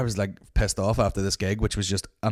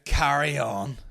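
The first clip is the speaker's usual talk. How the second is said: sarcastic; 5 semitones higher, slower than usual. Retold or imitated. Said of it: "she kept going like this".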